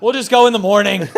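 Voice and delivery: loud, high, laughing mock plea; pleading dad. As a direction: call it out over the top, half laughing. Dad's louder repeat to child.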